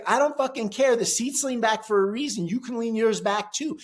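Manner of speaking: heated, high-pitched demand. Retold or imitated. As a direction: push the argument up high, insisting. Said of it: "my voice is very raised".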